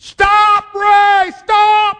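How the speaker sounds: high, loud shouted stop; screaming command. Retold or imitated. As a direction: yell it like a voice cutting through you. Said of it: "I mean, just screamed at me".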